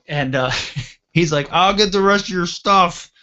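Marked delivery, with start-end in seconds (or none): 1.5-3.1 high, raised voice mimicking a buddy; helpful buddy hollering, acted out; imitated calling out. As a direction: call out higher and louder, as a retold quote. Buddy calling out while helping.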